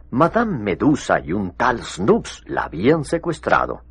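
ordinary talk, nothing marked

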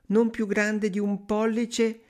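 high, slow yearning woman's voice; wistful sighing wife. A narrator's reading. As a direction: draw it out high, full of longing. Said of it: "rispose la donna sospirando". (moglie sospirosa, acuta e lenta)

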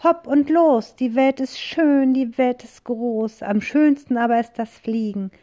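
high, loud joyful call; joyful witch cry. A narrator's reading. Witch calling out happily before takeoff, high and loud. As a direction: cheer for the flight. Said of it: "ruft die Hexe".

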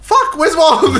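high-pitched, laughing mock panic; mock alarm. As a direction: cry out in comic alarm. Acts out sudden alarm about Wong.

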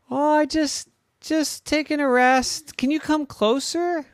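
high, loud sweet-old-lady put-on; a wolf faking grandma's voice; disguised wolf playing a frail grandma; fake grandma.

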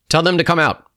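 loud, high military command; barked order. A narrator's reading. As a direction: order them out forcefully. Soldier giving an order.

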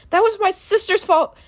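high, very loud outburst; yelled accusation. A narrator's reading. Knowledge blaming her sister for the attack, yelled. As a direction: blurt the blame at full volume. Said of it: "she yelled".

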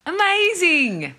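high, slow, delighted; excited. Real, not acted. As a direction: stretch it out with delight. Friend hears good news.

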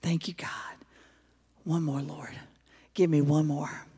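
soft, breathless whisper of prayer; hushed plea to God. Retold or imitated. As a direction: pray under your breath, exhausted. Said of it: "he takes a minute and says".

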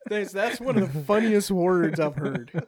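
talking through laughter; loud and slow, laughing. Real, not acted. Laughing at funny word.

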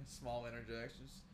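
ordinary talk, nothing marked

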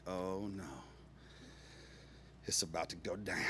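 low, hushed sense of doom; retold sinking dread, soft and low; quiet dread. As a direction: say it under your breath, dreading it. Dread at stranger approaching.

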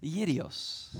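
"Yirios" said the Greek way, with an opening Y sound; Greek pronunciation. Shown as a demonstration. Preacher showing congregation the name, Greek pronunciation of "Yirios". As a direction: say "Yirios" with a Y, not a J. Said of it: "The Greek pronunciation is what I use".

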